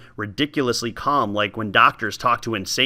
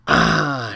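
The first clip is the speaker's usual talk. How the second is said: much lower shuddering sigh of relief; relaxed sighing ah. Acted. Old woman feeling the cold water, relieved sigh. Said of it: "she sighed with a relaxed shudder".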